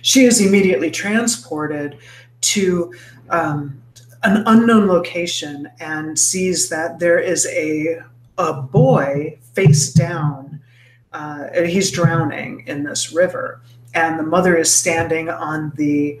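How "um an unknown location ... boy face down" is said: ordinary talk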